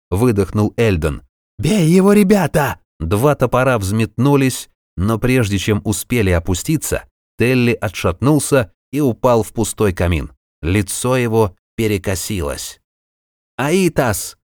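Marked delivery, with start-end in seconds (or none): 1.6-2.8 high, loud rallying shout; battle cry. Acted. Dwarf rallies comrades to attack. 13.6-14.4 high, sharp cry; sudden shout. Acted. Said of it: "вдруг крикнул он".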